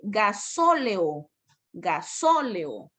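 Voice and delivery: "gasóleo" said slowly with a long SÓ; teacher's slow demo of stressed SÓ in "gasóleo"; stressed syllable drawn out. Shown as a demonstration. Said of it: "Cuando usted lo observa".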